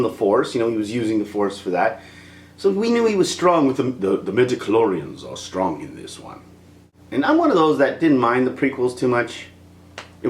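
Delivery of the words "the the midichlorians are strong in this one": lowered quote voice. Retold or imitated. Quoting Star Wars line.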